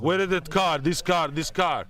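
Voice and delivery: high, insistent mock Russian; Russian accent. Russian exporter shoving paper at salesman, insistent mock-Russian demand. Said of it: "Russian dudes showing up".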